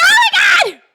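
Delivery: high, loud re-enacted shriek; squealed disbelief, acted out; retold scream. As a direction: shriek it, reliving the thrill. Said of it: "I started screaming bloody murder".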